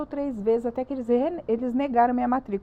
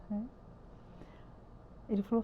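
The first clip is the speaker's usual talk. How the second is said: ordinary talk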